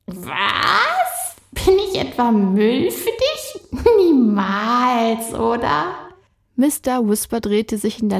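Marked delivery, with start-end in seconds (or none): 0.1-6.1 higher, teasing spirit; playful spirit voice. Acted. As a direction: tease like a cheeky sprite.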